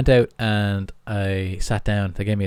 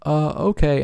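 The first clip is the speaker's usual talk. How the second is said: higher, louder imitation of a thrown customer; let-down customer; 8 semitones higher, 5 dB louder than usual. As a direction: lightly mimic a customer giving in. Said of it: "And they'd be like".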